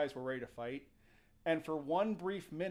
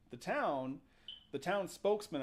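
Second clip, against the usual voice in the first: ordinary talk, nothing marked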